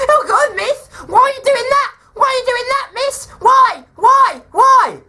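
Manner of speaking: high, whiny schoolkid voice, building to loud cries of why; mocking impression of pupil. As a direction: mimic a pupil's shrill whining protest. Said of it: "a horrible impression of you".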